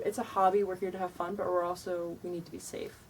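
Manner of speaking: ordinary talk, nothing marked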